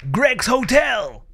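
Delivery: slow, drawn-out announcer voice for the hotel name; dramatic title announcement. Pretend. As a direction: announce it like a spooky game title.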